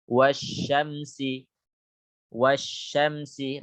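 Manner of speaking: Wasyamsi recited slowly with the lam silent; slow recitation. Shown as a demonstration. Teacher recites Wasyamsi slowly so students hear the silent lam. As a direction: draw out Wasyamsi like Quran reading. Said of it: "Lamnya tidak dibaca".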